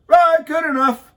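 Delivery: high, loud, breezy mock agreement; mock easy surrender. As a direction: toss it off like a pushover. Mocking a councilman folding at once.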